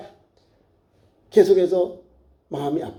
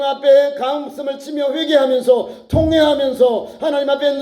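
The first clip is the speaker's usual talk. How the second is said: raised, insistent preacher's cadence; fervent preaching; 7 semitones higher than usual. Real, not acted. Preacher urging tearful prayer for families, fervent exhortation. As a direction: drive each phrase on in urgent exhortation.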